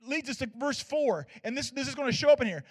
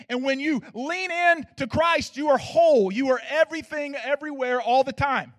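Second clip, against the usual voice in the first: preaching crescendo; 3 semitones higher, 7 dB louder than usual. Acted. Preached climax on being whole.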